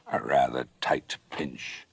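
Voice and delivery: plain reading voice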